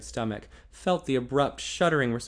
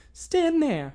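higher, louder resolute woman; determined young woman; 4 dB louder than usual. A narrator's reading. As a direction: insist with fierce conviction. Female character voiced.

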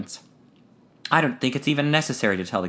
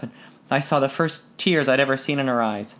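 plain reading voice

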